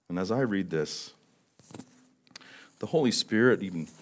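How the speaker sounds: ordinary talk, nothing marked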